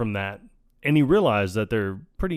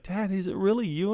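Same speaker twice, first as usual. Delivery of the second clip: lightly voiced hopeful daughter, higher pitch; mock girlish question, pitched up; 9 semitones higher than usual. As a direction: imitate a daughter calling to her dad. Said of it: "she's like".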